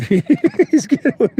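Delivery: high, slow, giggling through the words; laughing. Real, not acted. Amused by the goggled dog to viewers, laughing. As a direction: dissolve into real laughter.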